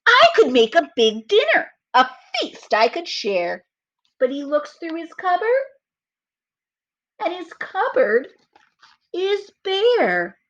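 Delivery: put-on slow, lively read-aloud for children; storybook reading. A narrator's reading. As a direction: read it like story time for little kids. Reads story narration aloud.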